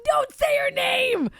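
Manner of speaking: high, drawn-out shout; urgent protest. Real, not acted. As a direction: cry out to stop them, stretching it. Cutting off friend to protect name.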